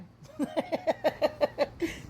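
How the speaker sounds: high, bubbling run of laughter; real laugh. Real, not acted. Storyteller amused by her own demand, high laughing. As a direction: burst into a long laugh.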